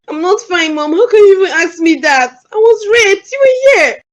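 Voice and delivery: rising into high, shouted sobs; crying outburst. Acted. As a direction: accuse her mother through tears, building to a cry.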